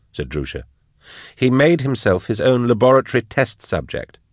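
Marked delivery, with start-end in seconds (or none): 1.4-4.2 withering. Acted. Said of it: "said Jaff witheringly".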